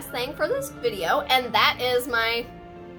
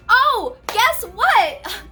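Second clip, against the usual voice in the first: playful surprise; 7 semitones higher, 7 dB louder, much slower than usual. Acted. Playful reveal to viewers.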